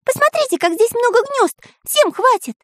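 very high excited cartoon voice. A narrator's reading. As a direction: squeal with delight at the nests. Pencil excited about nests.

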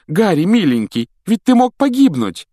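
very high, loud alarm; near-panicked mother; frantic worry. Acted. Mrs Weasley fusses over Harry, frantic and high.

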